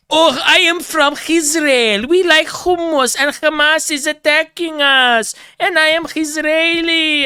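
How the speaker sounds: exaggerated fake Israeli accent, high and slow; mock Israeli accent. Parodying people faking an Israeli accent, mock Israeli accent. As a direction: ham up a put-on Israeli accent. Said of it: "pretend that they have an Israeli accent".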